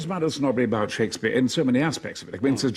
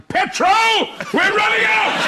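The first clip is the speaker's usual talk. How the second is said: high, loud tabloid alarm; dramatic headline shout; 6 dB louder than usual. Pretend. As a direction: bellow it like a panicked headline. Reading tabloid headlines melodramatically.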